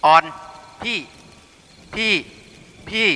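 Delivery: stuck on one word, breaking off each time; a flustered man who cannot get it out; lightly acted tongue-tied stammer. A narrator's reading. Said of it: "กลายเป็นคนติดอ่างขึ้นมา".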